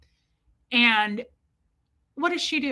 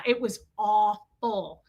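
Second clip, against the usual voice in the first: normal talking voice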